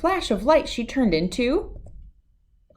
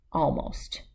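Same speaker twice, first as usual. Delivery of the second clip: soft whispered almost; whispered aside; 3 semitones lower, 7 dB quieter than usual. A narrator's reading. Narrator whispering a sly aside to listeners. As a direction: whisper it like a secret.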